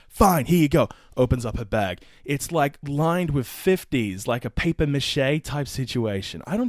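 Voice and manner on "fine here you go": higher, louder huffy retort; mock huffy. Shoplifter retold giving in, mock huffy. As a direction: hand it over in a mock huff. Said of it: "He says".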